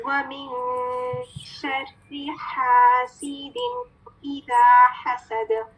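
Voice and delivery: slow, drawn-out tajweed recitation; Quranic recitation. Shown as a demonstration. Teacher rereads the last verse for students, slow recitation. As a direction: recite the verse slowly and carefully. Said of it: "मैं दोबारा से last आयत को पढ़ती हूँ, गौर से सुने".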